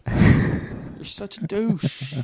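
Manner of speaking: slow, stretched teasing jab; drawn-out mock insult. Real, not acted. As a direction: drag out the insult playfully. Mocking friend, drawn out.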